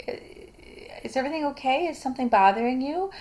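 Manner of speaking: concerned husband. Acted. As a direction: gently check on your partner. Husband's concerned question, acted.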